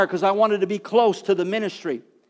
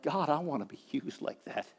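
hushed longing prayer; 5 semitones lower, 13 dB quieter than usual. Retold or imitated. And I'm like.

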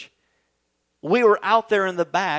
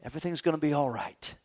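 put-on softer, gentle reassurance to a hurt child; soothing dad; 3 semitones lower, 8 dB quieter than usual. Father comforting his stung little boy, softer and tender. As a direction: hush and comfort him like a father. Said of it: "And I said".

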